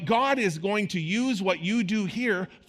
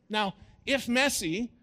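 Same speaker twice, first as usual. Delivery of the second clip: usual speaking voice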